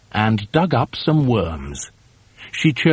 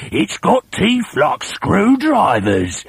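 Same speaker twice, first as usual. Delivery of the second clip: put-on slow, higher spooky old man; sly husband; 8 semitones higher, slower than usual. A narrator's reading. Husband scares his wife about the beast, slow sly voice. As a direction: drag it out to scare her. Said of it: "Mister Twit said".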